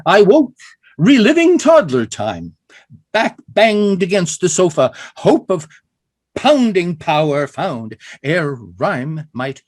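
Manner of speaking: loud measured recital, four beats a line; slow emphatic poem recital; poem reading. Shown as a demonstration. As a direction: read it out strong, marking each beat. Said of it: "this is in four beats".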